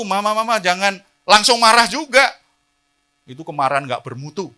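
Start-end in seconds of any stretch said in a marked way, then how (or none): 0.0-2.3 put-on protesting child. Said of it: "Kalau anaknya seperti itu".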